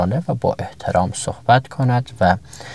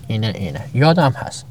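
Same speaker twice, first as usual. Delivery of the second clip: ordinary talk, nothing marked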